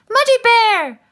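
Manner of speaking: high, loud call of a name. Acted. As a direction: shout the name into the cave. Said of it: "called Nut".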